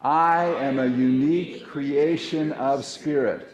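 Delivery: loud proclamation. Shown as a demonstration. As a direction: proclaim it to the room, slowly. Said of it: "Let's say".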